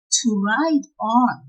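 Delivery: normal talking voice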